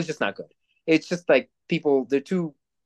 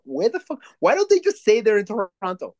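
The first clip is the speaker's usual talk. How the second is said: exasperated rant; 6 semitones higher than usual. Retold or imitated. Retells own rant about fake New York.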